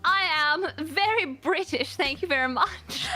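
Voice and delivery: very British, playfully put out; British accent with prim mock indignation; mock-indignant British. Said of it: "do you have an Australian accent?".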